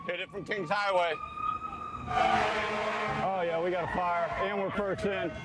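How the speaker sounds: urgent firefighter radio. Real, not acted. Clip of firefighters at fire scene.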